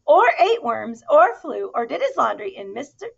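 high, loud motherly scolding. Acted. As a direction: tell him off like a fed-up mum. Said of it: "she scolded".

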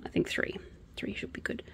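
whispered to herself. Real, not acted. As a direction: whisper the count under your breath. Counting squares aside.

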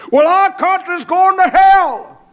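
loud, high, slow mimicked wail; mock outcry. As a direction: cry it out like an alarmed complainer. Mimics what people say, mockingly.

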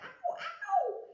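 very high squeaky cry of pain. Acted. Frog seized by the trout cries out in pain.

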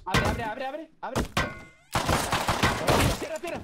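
high, loud urgent commands; urgent shout. Real, not acted. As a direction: bark orders mid-fight.

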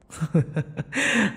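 a quick, high, pleased laugh; smug laugh. Acted. Vampire laughing at his captive's imagined desire, smug. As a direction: laugh like you caught them out. Vampire teasing about hot body.

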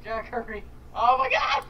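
high, frantic cries; panicked screaming. Real, not acted. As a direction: cry out in panic for help. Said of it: "Sorry, I screamed".

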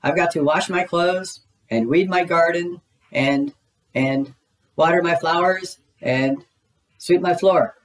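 high-pitched busy Rabbit voice; Rabbit calling. A narrator's reading. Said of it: "Rabbit called from his garden".

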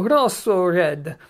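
put-on playful Italian accent on rosso red. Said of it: "There's my Italian accent for you again".

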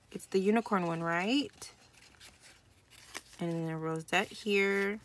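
usual speaking voice

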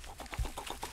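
whispered go go go, hurried; urgent whisper. Acted. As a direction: hiss them on without being heard. Urging goat away in secret.

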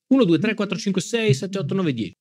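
high, sing-song mic check; mic test count. Shown as a demonstration. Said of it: "test del microfono".

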